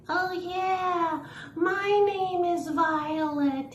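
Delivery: high, slow, sing-song sock puppet. Pretend. Sock puppet Violet introduces herself, high and slow. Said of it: "create something that's fun for you, like".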